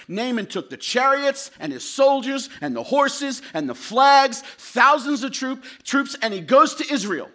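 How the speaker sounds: sermon climax building in force; rising preaching. Acted. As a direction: build the march to Israel with fire.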